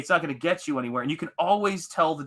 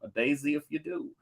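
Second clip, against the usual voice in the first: Tombstone quote; 7 dB quieter than usual. Retold or imitated. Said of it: "See, I just went into Tombstone".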